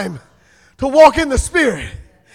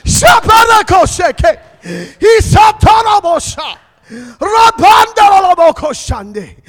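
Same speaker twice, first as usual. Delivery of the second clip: shouted tongues; 3 semitones higher, 6 dB louder, slower than usual. Real, not acted. Preacher shouting in tongues over the altar. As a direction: pour out the prayer language.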